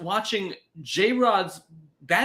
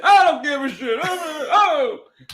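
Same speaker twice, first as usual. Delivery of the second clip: loud, higher mock outburst shouting "oh, oh"; impression of his friend; 10 semitones higher, 6 dB louder, slower than usual. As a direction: belt it out like a fed-up buddy. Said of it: "It's a great impression".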